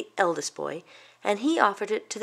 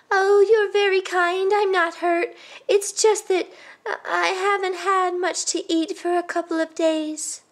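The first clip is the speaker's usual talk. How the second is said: high, unhurried granny voice; frail, hungry elder explaining politely; grateful old woman; 11 semitones higher than usual. Acted. Old woman confiding hunger to Mama, high and grateful.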